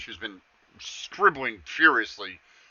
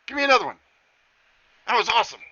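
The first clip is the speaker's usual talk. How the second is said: much higher and louder, begging for more; excited satyr; 11 semitones higher, 8 dB louder, much faster than usual. Acted. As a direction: beg for another trick like a thrilled kid. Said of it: "he's like".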